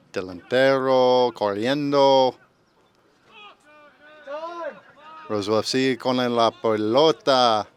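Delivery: Spanish commentary. Acted. Commentator tries to spice up the game, Spanish commentary. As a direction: stretch the Spanish words out like a match call. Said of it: "I'm trying to give Spanish and".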